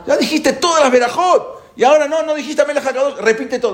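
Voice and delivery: high, laughing disbelief, rushed; mock exasperation. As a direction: throw up your hands, half-laughing. Mock complaint about repeating prayers. (exasperación burlona, aguda y entre risas)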